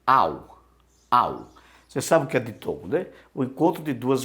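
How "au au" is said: au said low and drawn together as one glide; Greek diphthong au. Shown as a demonstration. Teacher showing students the Greek diphthong au. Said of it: "Você tem aqui um ditongo".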